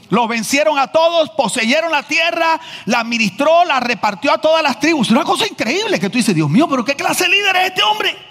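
high, building preacher's cry; preaching climax. Acted. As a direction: build up to a ringing shout of wonder. (predicación que sube hasta un grito de asombro)

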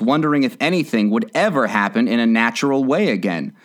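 ordinary delivery, nothing marked

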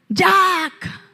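loud, high dramatic call of a name; hammed-up movie wail for Jack; mock Titanic cry. Preacher reenacting Rose calling Jack.

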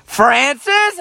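high yelled call of a name; mom yelling name. Retold or imitated. Mom calls son from across the house, loud yell. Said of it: "And my mom yells".

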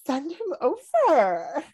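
high, drawn-out gushing imitation; mock swooning eagerness. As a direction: draw it out high, like an eager admirer. Acting out the interested girl.

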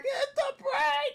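slow, very high mock scream; hammed-up desperate warning; imitated screaming. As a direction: stretch it out in a frantic high yell. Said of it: "like, he's screaming".

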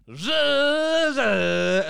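drawn-out buzzing engine sound; imitated car noise. Friend telling of Brandon's burnout, imitated car revving.

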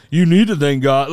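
mock admonishing, leaning on the words; imagined scolding. What he wanted to tell the woman, as a forceful mock scolding. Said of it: "In my head, I wanted to be like".

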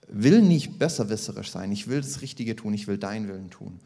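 ordinary talk

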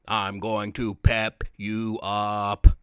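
low, slow booming monster; monster bellow. Acted. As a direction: bellow it like a giant beast. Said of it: "continually bellowed".

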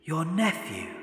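ghost voice. A narrator's reading. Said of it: "said the ghost".

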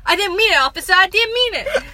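put-on high, loud New York accent pleading; a hint of New York, begging the officer; New Yorker accent. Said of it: "That was a good New Yorker accent".

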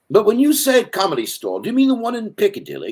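put-on very high, loud British accent; hammed-up high British voice; British character. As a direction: play the clueless Brit asking politely. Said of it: "this British character".

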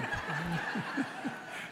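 a soft rising laugh. Real, not acted. Preacher telling the congregation about the bus, softly laughing. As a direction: let a quiet laugh slip out.

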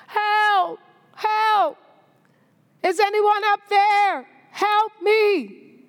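high, desperate cries for help from below; yelling for help. Acted. Jack on the cliff begs anyone above for help, high desperate yell. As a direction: shout up the cliff as if dangling. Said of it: "So Jack began yelling for help".

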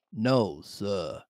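soft, low, meek reply; Morgan. A narrator's reading. Old sailor denying it to Silver, low and meek. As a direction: answer low and quiet as the sailor. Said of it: "And then we get Morgan".

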